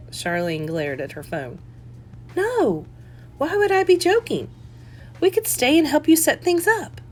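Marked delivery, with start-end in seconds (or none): 2.4-7.0 higher mom voice, a little hurt; eager mother. Acted. Mother pushing to visit her daughter, higher and eager. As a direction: mom offering to help, higher.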